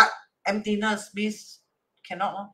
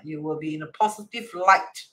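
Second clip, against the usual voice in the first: ordinary delivery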